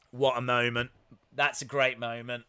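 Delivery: ordinary talk, nothing marked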